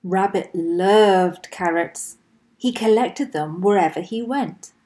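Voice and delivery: usual speaking voice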